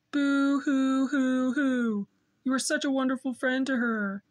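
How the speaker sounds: mock-weepy, syrupy crying echo; mushy movie voice. Boy mimicking a weepy adult in mocking sobs. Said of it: "He sounded like someone in an old mushy movie".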